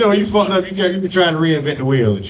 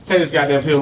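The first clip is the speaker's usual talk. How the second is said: ordinary delivery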